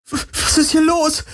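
viel höher, panisch stockend; überschlagende Stimme. Acted. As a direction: die Stimme kippt vor Angst nach oben. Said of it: "Kirais Stimme überschlug sich". (Kirai fragt panisch, Stimme überschlägt sich hoch)

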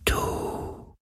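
very slow, toneless whispered breath; barely audible, drawn-out whisper; faint whisper. A narrator's reading. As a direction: whisper it like a dying wind. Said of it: "so leise wie der Schatten des Hauchs eines Flüsterns".

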